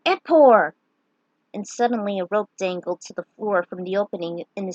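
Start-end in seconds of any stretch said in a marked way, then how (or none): 0.1-0.7 Epor bellowed high and loud; shouted spell. Acted. Hero shouting the password at the sign, loud. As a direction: call out Epor like a magic word. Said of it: "He took a deep breath and said".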